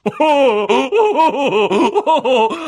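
very high, loud mocking laugh; gleeful cackle. A narrator's reading. Peeves laughing at duped Filch, gleeful cackle. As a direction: cackle long and wildly.

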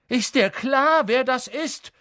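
put-on high, loud young centaur pushing back; defiant retort. A narrator's reading. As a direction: challenge Bane's anger. Firenze defends himself to Bane.

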